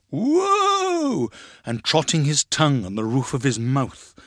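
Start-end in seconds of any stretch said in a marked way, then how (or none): 0.1-1.3 long, high-pitched whoa; crying out. A narrator's reading. Man in next room halting imaginary horses, crying out. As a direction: haul the horses in with a drawn-out call. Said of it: "heard the man in the next room crying".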